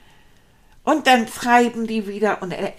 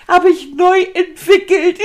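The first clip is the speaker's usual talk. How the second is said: higher, louder, through laughter; laughing proudly; 7 semitones higher, 8 dB louder than usual. Acted. Rat jokes about his new cake, laughing. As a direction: brag while you giggle.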